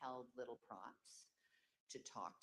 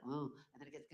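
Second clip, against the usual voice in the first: ordinary talk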